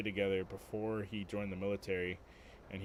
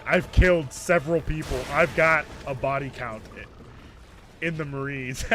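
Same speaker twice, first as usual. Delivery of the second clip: loud, raised-voice imitation of a menacing Marine; hammed-up menace, loud and emphatic; mock serious threat; 5 semitones higher, 12 dB louder than usual. Imitating brother's Marine friend turning threats serious, mock menace. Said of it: "it went from playful, like".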